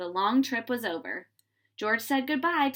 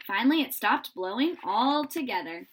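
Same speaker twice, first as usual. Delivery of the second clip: usual speaking voice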